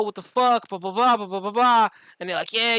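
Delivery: ordinary talk, nothing marked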